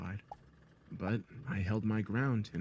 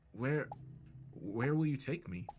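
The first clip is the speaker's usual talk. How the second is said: nervous stutter; 3 semitones higher, 3 dB quieter than usual. A narrator's reading. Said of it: "I inquired nervously".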